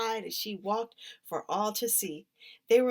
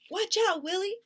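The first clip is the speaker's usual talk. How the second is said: sharp, much higher, louder warning; warning call; 12 semitones higher, 5 dB louder than usual. A narrator's reading. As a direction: call out to stop her sister. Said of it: "Dreeny warned".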